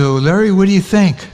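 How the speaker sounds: a hint of a buoyant young man. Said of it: "he sounded really beautiful and young".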